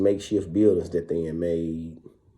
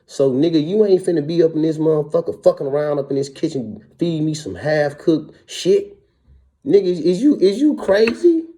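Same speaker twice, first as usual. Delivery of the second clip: much higher and louder, heated challenge; imitated angry confrontation; 8 semitones higher, 7 dB louder than usual. Inmate confronting the kitchen cook over half-cooked food, angry and much higher. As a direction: throw it at him like he's lost his mind.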